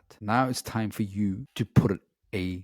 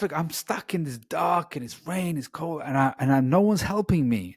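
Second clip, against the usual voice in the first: frustrated; 7 semitones higher than usual. Retold or imitated. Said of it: "angry and frustrated".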